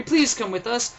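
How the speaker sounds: put-on high, loud child's plea; a boy begging the stranger to come.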